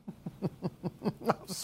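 breathy stifled laugh; giggling helplessly under the breath. Real, not acted. Host cracking up at a silly headline, stifled laugh.

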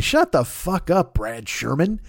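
ordinary delivery

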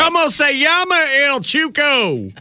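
Spanish in a Southern US accent; Southern drawl on Spanish words. Shown as a demonstration. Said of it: "I start to have my southern accent when I do my Spanish".

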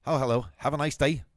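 put-on cheery Scottish-accented greeting; chirpy Scottish lilt, bright and polite. Small Scottish EA host greeting Warren Sapp, Scottish accent. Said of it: "mit schottischem Akzent".

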